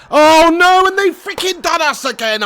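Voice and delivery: high wail of frustration, loud at first; dismayed shout. Real, not acted. Gamer reacting to conceded goal, dismayed high shout. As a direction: cry out at being scored on.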